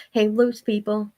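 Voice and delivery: usual speaking voice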